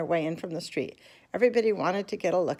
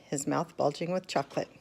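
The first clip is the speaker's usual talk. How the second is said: ordinary delivery, nothing marked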